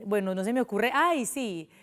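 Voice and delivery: ordinary talk, nothing marked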